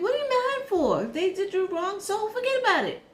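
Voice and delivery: high, loud, fed-up scolding; telling a chronically mad friend to move on, loud and exasperated; exasperated lecture. Retold or imitated. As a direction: shout it at the grudge-holder in disbelief. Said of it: "I'm like".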